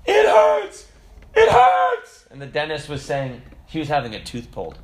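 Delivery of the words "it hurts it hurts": put-on high, loud childish wail of pain; daughter's pained cry. Daughter at the dentist, wailing it hurts. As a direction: cry out like a kid in the dentist chair. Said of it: "and she's like".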